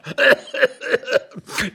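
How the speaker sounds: high, prolonged giggling; amused laughter. Acted. Laughing at the dusty intruder, tickled.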